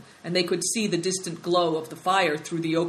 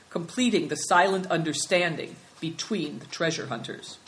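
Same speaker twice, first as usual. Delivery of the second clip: plain reading voice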